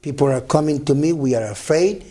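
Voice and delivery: usual speaking voice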